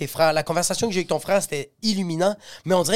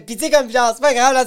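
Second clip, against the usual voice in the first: éducatrice imitée; 6 semitones higher, 6 dB louder than usual. Retold or imitated. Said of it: "elle fait".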